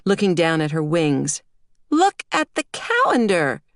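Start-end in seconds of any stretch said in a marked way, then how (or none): 1.9-3.6 put-on high-pitched, bossy child voice; insistent little girl. A narrator's reading. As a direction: instruct Daddy like a know-it-all preschooler. Small girl instructs her father.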